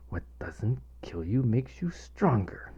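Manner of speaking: soft whisper to himself; whispered mantra. Retold or imitated. Worried father repeating his mantra, whispered. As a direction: whisper it to calm yourself. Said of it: "I repeated over and over".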